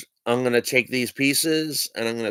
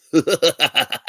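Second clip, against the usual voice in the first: higher-pitched run of laughter; pleased laugh; 5 semitones higher, 4 dB louder than usual. Real, not acted. Showing viewers the vanished ace, a pleased laugh. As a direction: laugh at your own trick.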